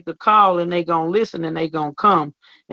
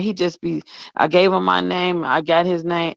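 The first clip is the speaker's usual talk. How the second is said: usual speaking voice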